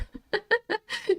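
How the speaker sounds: high bubbly laugh. Real, not acted. Streamer laughing with her chat. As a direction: laugh it off brightly.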